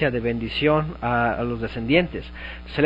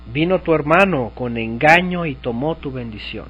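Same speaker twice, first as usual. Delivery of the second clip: ordinary talk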